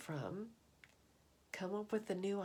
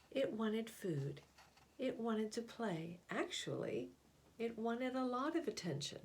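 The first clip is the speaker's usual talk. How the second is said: put-on gentle storybook narration for children; picture book reading. A narrator's reading. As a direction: read it like a bedtime picture book. Reads picture book aloud.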